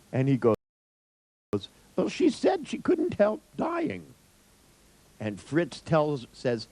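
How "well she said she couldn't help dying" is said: high, slow imitation of a pitiful patient; slow, pitched-up mock sorrow; mock tearful. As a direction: put on a high, plaintive voice. Imitating patient voicing dead mother's answer.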